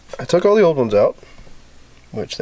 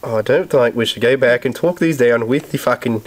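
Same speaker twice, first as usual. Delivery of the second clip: mock Aussie accent, played for laughs; bad Australian accent. Joking with his buddy while wrenching, bad Australian accent. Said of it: "I'm murdering your accent".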